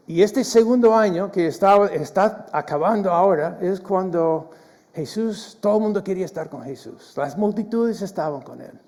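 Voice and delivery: loud, driving sermon delivery; full-voiced pulpit preaching; fervent preaching. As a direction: preach it out to the congregation.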